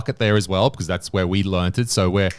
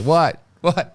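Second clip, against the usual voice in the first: puzzled mock audience; 8 semitones higher than usual. Confused audience voiced.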